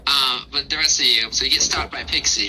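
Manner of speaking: normal talking voice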